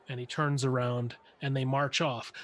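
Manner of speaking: ordinary talk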